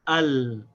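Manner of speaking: crisp "al", short a and light l; Arabic syllable al. Shown as a demonstration. Teacher showing the syllable "al" to a learner. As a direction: say "al" as one syllable. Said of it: "They get pronounced as".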